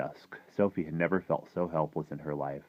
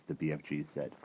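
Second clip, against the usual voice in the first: ordinary delivery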